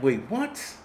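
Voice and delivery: disbelieving, voice shooting up; incredulous. Retold or imitated. Reacting to OnlyFans earnings, high incredulous. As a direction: pitch leaps high on in the fuck. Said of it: "And I'm like".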